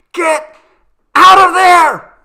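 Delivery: put-on a terrified scream at a child; panicked scream. A narrator's reading. Frightened mother screaming at daughter to leave the closet.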